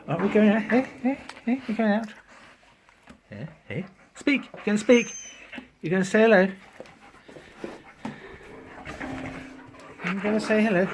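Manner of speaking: high sing-song voice for the dog; pet talk. Acted. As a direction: coo at an excited puppy. Coaxing the dog to speak.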